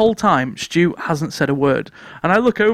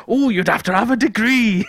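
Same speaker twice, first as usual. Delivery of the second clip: put-on lilting Welsh accent, pitched up; 4 semitones higher than usual. Retelling a mate's unsubtle question, Welsh accent. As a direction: put on a high, sing-song Welsh voice. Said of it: "why he's [name]".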